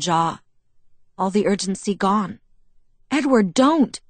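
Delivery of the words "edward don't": higher, louder objection; protesting. A narrator's reading. Bella starts to argue with Edward, protesting. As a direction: start to argue, pitch jumping up. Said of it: "I started to argue".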